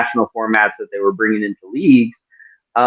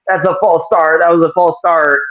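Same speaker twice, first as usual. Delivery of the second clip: mock sideline yell; 6 semitones higher than usual. Player on the sideline calling to the official, mock yell. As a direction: imitate a complaint yelled from downfield, without real volume. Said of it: "on the sideline yelling".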